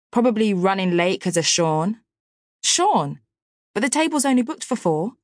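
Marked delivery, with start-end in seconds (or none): 2.6-3.2 surprised. Acted. Surprised Hannah brought Sean. 3.7-5.1 high complaint. Acted. Annoyed complaint about table.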